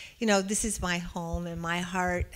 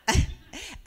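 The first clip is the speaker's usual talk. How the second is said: short high-pitched laugh; brief amused laugh after a punchline; real laugh; 11 semitones higher, 4 dB louder than usual. Real, not acted. Speaker amused at how readily women talked, short high laugh.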